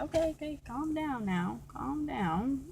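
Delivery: soft, placating retold thought; calming inner voice. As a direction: quietly soothe someone worked up. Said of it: "in my head, I'm like".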